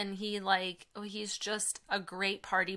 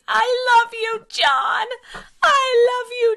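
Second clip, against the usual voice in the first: put-on high, loud little-boy yell through a door; imitated child's shout; 13 semitones higher, 12 dB louder, slower than usual. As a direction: holler it with all your heart, imitating a toddler. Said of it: "Wyatt was at the door yelling".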